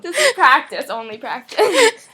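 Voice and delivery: laughing reassurance. Real, not acted.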